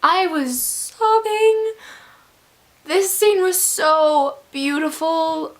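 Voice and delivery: high, drawn-out emotional wail; slow, high-pitched fangirl swoon; overcome gushing. Real, not acted. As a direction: stretch it out, swept away by the scene. Overcome retelling a love scene.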